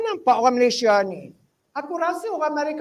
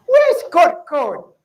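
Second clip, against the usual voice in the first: much higher and louder on the first kot; exasperated outburst; 5 semitones higher, 9 dB louder than usual. Real, not acted. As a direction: burst out in mock exasperation. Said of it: "exasperated at the word kot".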